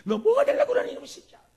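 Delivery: high shrill-free loud yell that drops to a hiss; scolding child in temper. Retold or imitated. Parent losing temper at a disobedient child, mock yelling. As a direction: snap at the kid in a temper, then mutter. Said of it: "내 성질대로".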